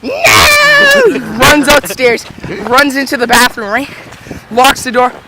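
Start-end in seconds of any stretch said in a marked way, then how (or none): 0.0-1.0 very high, loud, drawn-out squeal; mock kid scream. Cousin fleeing birthday beats, high mock scream. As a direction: shriek it like a fleeing little boy.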